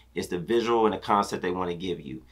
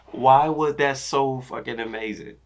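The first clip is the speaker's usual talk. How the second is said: higher, slow, disbelieving and awed; amazed; 5 semitones higher than usual. Real, not acted. Reacting to the video, slow awed disbelief. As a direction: marvel at it, slowly.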